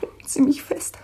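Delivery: breathless pleading whisper; desperate whisper. Acted. Abandoned woman to her friend, pleading in a whisper. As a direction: beg in a whisper. Distraught woman begging for support.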